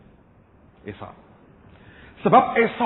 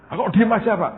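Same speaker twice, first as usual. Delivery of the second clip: impatient complaint; 7 semitones higher, 7 dB louder than usual. Pretend. Complaining to silent Holy Spirit.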